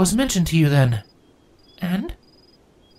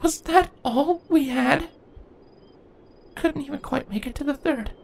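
grieving; 4 dB quieter than usual. A narrator's reading. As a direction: ask it into the empty tent. Twilight grieving alone.

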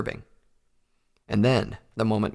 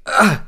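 sharp loud cry of pain; high pained outburst; pained grunt; 12 semitones higher, 10 dB louder than usual. A narrator's reading. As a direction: yelp as the jaw hurts. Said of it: "Stoneshot exclaimed".

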